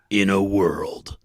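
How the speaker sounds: slow, deep epic trailer announcer; In a world, drawn out like a blockbuster narrator; movie trailer voiceover. Retold or imitated. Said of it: "The guy goes".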